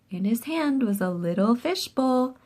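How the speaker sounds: ordinary delivery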